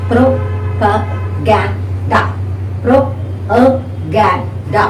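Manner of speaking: Propaganda very slowly, broken into pro-pa-gan-da; syllable by syllable. Shown as a demonstration. Teacher counting syllables for learners, Propaganda in slow separate syllables. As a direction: separate every syllable of Propaganda with a small gap. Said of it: "syllable identification will help you".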